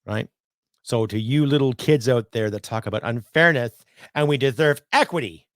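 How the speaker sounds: usual speaking voice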